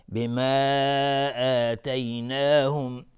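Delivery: "بما" with its final a held very long before "آتیناهم"; long madd. Shown as a demonstration. Quran teacher demonstrates the permitted lengthening, long held vowel. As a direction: stretch the end of "بما" on a sustained note.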